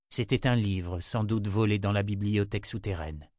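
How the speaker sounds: plain reading voice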